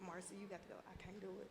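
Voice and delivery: very soft, breaking with emotion, barely holding it together; choked up. Real, not acted. Grieving mother handing the story to her lawyer, choked and very soft. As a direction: voice fading away as tears come. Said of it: "'cause I'm getting emotional".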